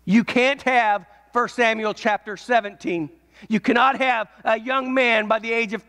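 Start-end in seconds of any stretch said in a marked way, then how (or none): none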